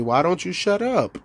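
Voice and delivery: ordinary delivery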